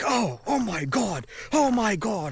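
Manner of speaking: high, drawn-out dismay; exasperated guard. Retold or imitated. Guard hears where sand came from, exasperated. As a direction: imitate a guard appalled by the answer.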